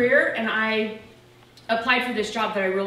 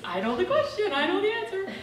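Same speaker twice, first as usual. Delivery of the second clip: high, eager mock of her younger self, laughing; smug know-it-all; 10 semitones higher than usual. Telling friends how she answered, high cocky know-it-all. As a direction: play the keen student sure she has it. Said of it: "And I was like".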